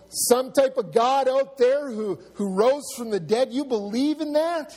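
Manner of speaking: high, loud scoffing question; acted ridicule, pitch climbing on the question; mocking disbelief. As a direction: act out an incredulous mocker. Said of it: "You're gonna get mocked".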